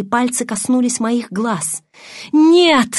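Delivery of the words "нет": high, loud shout of refusal; explosive cry; roaring. A narrator's reading. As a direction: roar out in protest. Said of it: "заревел Каллен".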